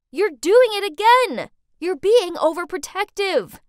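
loud, high, angry accusation; shouting back. A narrator's reading. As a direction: yell back at an overprotective mom. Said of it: "I shouted back".

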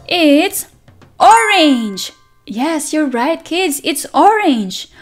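ordinary talk, nothing marked

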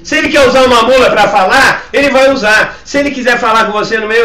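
high, loud sermon cry; forceful preaching. Real, not acted. Pastor answering doubters about how God speaks, loud forceful preaching. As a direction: argue it out to the congregation at full voice.

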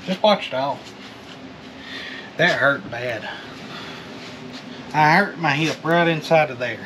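ordinary talk

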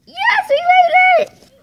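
very high, loud squeal of victory; triumphant cheer. Real, not acted. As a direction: celebrate the win in a high yell. Cheering a success in the game.

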